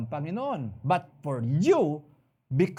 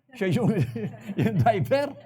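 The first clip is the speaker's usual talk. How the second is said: laughing; 6 semitones higher, 5 dB louder than usual. Real, not acted. Preacher laughs recalling the driver.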